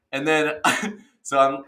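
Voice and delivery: usual speaking voice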